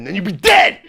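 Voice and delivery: sudden loud punch on DEAD; shouted warning. Acted. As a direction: blurt it out as a dire warning. Acted passenger warning about Mexico.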